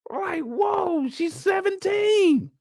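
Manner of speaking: high, slow shocked yell; shocked shout. Real, not acted. Recapping a reality show, stunned by her age. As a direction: shout it out in disbelief.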